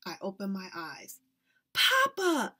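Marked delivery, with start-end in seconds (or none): 1.7-2.5 high, loud relieved shout; girl crying out to her father; excited cry. Acted. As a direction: cry out at the rescue. Child sees father arrive.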